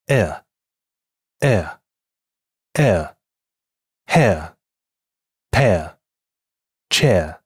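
slow drawn-out demo of air: air, hair, pear, chair; teacher slowly models each air word; model English air sound. Shown as a demonstration. Teacher shows learners how air is said. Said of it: "它是这么发音的".

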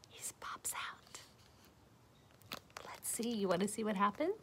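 soft unvoiced teasing whisper. Real, not acted. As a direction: whisper like sharing a secret. Teaser whisper to audience.

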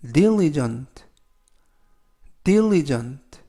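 slow, careful English model of diligent; model pronunciation. Shown as a demonstration. Teacher modelling diligent for students to repeat. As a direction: say diligent clearly, syllable by syllable. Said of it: "diligent라고 발음하시면 되겠고요".

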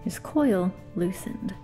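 ordinary delivery

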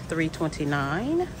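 ordinary talk

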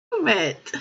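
ordinary talk, nothing marked

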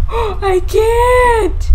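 terrified gamer; panicked cry. Real, not acted. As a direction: squeal out in panic. Panicked reaction.

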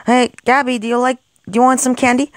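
high, fast tempting call; a quick sweet-talking pitch; eager coaxing. Acted. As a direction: lure her in quickly and brightly. Tempting Gabby with candy.

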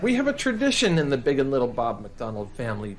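ordinary delivery

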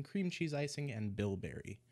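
ordinary delivery

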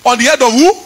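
shouted, sung preaching over the music; full-force preaching. Acted. Pastor calls down the God of vengeance in a fiery sung sermon. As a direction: preach it with fire and fury.

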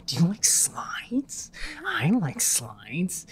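slow, teasing questions; slow mocking sing-song. Gamer teasing the baby before throwing it down the chute, slow sing-song. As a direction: taunt it sweetly before dumping it.

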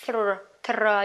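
"Throw" drawn out slowly from the TH; TH demonstration. Shown as a demonstration. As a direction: slowly sound out the "thr" opening of "Throw". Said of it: "T H được nữa này". (đọc chậm, rõ âm "TH" trong "Throw")

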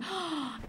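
quick gasp of discovery; sharp gasp. Acted. Storyteller acting the son's gasp of a new idea.

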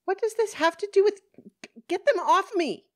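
very high, loud, frantic protest; exasperated outburst. Acted. Woman interrupting police as girls cling, exasperated outburst. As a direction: burst out, stumbling into a frantic demand.

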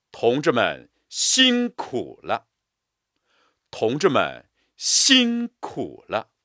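辛苦 punched, slow and full; slow, stressed reading. Shown as a demonstration. Teacher reading aloud, weight on 辛苦. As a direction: lean hard on 辛苦. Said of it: "加重力度和音强".

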